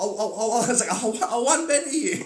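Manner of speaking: high, loud stammering fan; giddy star-struck stutter; stammering excitedly. Retold or imitated. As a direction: trip over your words in awe. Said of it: "I'd be like".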